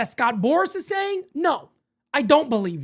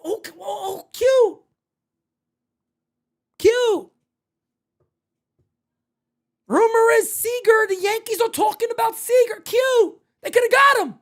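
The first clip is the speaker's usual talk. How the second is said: mock fan cries; 8 semitones higher than usual. Mocking fans' reaction.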